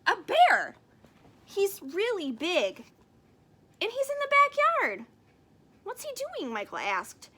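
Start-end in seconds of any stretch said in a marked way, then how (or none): 0.1-5.1 high, loud and breathless with news; a kid bursting to tell; wide-eyed child voice; excited child. Acted. Excited child reports bear. 5.8-6.5 put-on child voice. Child asks about bear.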